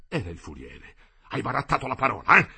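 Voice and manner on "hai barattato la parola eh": fast, louder, rasping menace; hoarse threatening growl; muffled rattle. A narrator's reading. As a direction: rattle it out from the throat. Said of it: "con voce sorda, sorda, che parve un rantolo".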